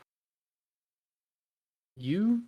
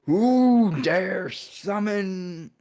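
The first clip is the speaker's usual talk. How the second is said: booming, drawn-out summoned-demon bellow; loud demon yell; 7 semitones higher, 6 dB louder, much slower than usual. Acted. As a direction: yell it slow, loud and high, fading into comic hesitation. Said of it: "yells very, very loudly".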